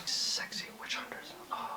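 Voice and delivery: hushed whisper; barely audible teasing whisper; whispered aside. Pretend. Whispered aside on witch hunters.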